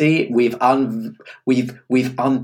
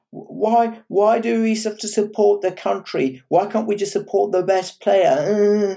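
slower, higher whiny mimicry; mocking naive voice; 8 semitones higher, slower than usual. Co-host parroting her old argument, mock naive. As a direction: parody her earlier argument. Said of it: "you said naively".

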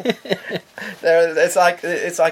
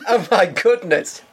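laughing; 7 semitones higher than usual. Real, not acted. Incredulous at cafe rule.

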